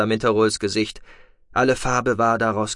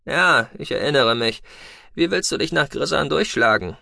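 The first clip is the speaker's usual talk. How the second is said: a lightly raised, brisk voice for the pilot Tech; Tech's voice; 4 semitones higher than usual. A narrator's reading. Tech telling the captive noble they are leaving, lightly voiced. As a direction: voice Tech a touch higher and brighter than the narrator.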